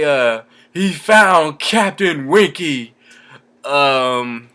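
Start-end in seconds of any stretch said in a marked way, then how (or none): 0.7-2.9 slow, higher put-on voice quoting the film; movie character quote. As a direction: drag out the line like the character.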